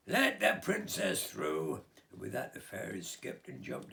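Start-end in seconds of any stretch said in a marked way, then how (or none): 0.1-1.8 high, loud, slow royal call; fairy's command. Acted. The fairy calls to the other fairies, a high loud slow command. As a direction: ring it out high and grand.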